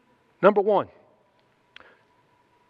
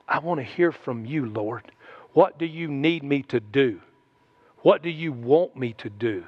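humble prayer; 7 semitones lower than usual. Retold or imitated. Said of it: "to go".

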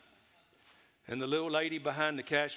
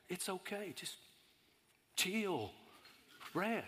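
calming the cashier; 3 semitones higher, 8 dB quieter, slower than usual. Retold or imitated. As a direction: hush her gently. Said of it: "I said".

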